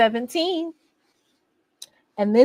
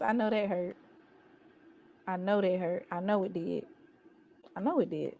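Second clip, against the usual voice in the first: soft mock-sympathetic murmur, a lot quieter; mock sympathy; 4 semitones lower, 11 dB quieter than usual. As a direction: pat them on the head, quietly. Mock sympathy after rival's loss.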